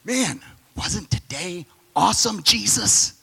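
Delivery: slow, high, eager disciple voice; excited apostle. Retold or imitated. Apostle by the fire praising the day to Jesus, excited. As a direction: gush about the day like a thrilled follower. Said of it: "they're like".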